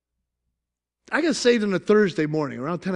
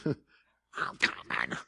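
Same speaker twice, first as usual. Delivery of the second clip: low, drawn-out groggy voice; lightly voiced groggy reply; mock sleepy groan; 9 dB quieter, much slower than usual. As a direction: drag it out half asleep.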